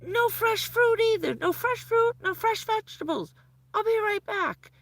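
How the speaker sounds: very high, loud, rushed warnings; panicked doctor. Retold or imitated. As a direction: fire off the orders like an alarmed doctor. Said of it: "But he was so panicked".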